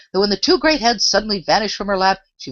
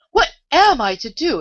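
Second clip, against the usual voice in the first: exclaimed; 10 semitones higher, 5 dB louder than usual. A narrator's reading. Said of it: "exclaimed Alice".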